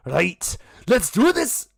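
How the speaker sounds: high, loud battle cry; king gearing up. Acted. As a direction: pump yourself up for the fight. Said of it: "he's like".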